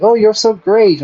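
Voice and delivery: high, gushing fawning voice; mock flattery. Explaining pander, mocks a sycophant's gushing. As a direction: gush over them like a flatterer. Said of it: "It's like".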